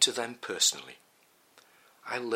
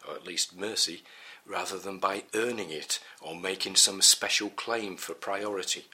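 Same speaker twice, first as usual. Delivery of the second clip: normal talking voice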